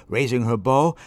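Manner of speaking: usual speaking voice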